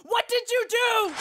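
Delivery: high-pitched, upset accusing cry; frantic accusation. Acted. Sips blaming companions for his crystal, frantic and accusing. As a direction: accuse them in a rising panic.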